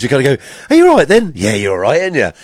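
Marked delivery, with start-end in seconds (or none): 0.7-1.3 high, loud cooing at the dog; owner's high doggy-talk voice. Retold or imitated. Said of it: "when you do talk to your dogs". 1.4-2.3 low reply on the pet's behalf; answering for the dog. Retold or imitated. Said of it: "You always answer for them".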